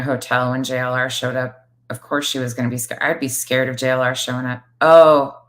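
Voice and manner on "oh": ordinary talk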